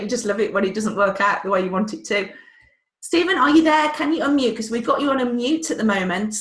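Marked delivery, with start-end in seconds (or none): 3.0-4.6 louder, higher call to an absent guest; host calls louder to a muted guest. Real, not acted. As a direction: call out to get his attention. Calling to silent guest.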